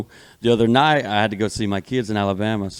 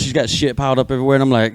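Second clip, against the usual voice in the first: ordinary talk, nothing marked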